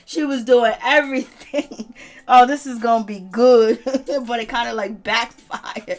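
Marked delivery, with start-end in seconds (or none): none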